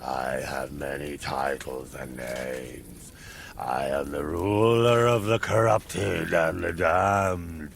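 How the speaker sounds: deep, slow booming demon lord; grim reaper. Acted. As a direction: proclaim doom, rising to a roar. Demon lord declares himself.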